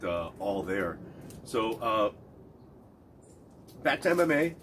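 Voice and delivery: ordinary delivery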